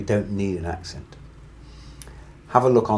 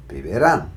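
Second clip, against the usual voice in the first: vivirán with emphasis on rán; vivirán, rising onto a strong rán; final-syllable stress; 4 dB louder than usual. Shown as a demonstration. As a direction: punch the last syllable of vivirán. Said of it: "stressing that very last syllable".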